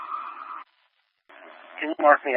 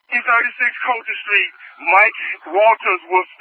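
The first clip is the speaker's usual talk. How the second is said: higher, tense radio report; urgent call; 8 semitones higher than usual. Real, not acted. Officer radioing an address to dispatch, tense and higher. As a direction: get the address out fast.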